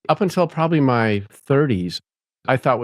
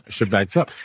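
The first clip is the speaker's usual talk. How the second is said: ordinary delivery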